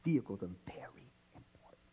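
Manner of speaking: whisper. Real, not acted. Confiding aside to congregation.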